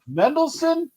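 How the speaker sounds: high, incredulous comedian lilt; Jackie Mason. Acted. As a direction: swoop up and cut each phrase off. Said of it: "I sound like Jackie the comedian".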